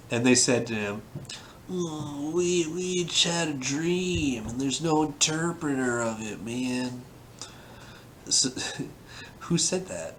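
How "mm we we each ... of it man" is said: laid-back dude voice. Acted. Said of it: "They said him in simultaneously".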